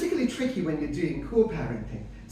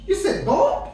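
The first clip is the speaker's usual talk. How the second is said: put-on high, loud child's question; little boy; 10 semitones higher, 5 dB louder than usual. Little son questioning his dad's story, high and surprised. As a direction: pipe up high like an intrigued kid. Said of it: "the little one looks at me".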